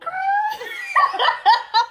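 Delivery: high screaming laugh; shrieking laugh. Real, not acted. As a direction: burst into a shrill shriek of laughter. Laughing hard at the joke.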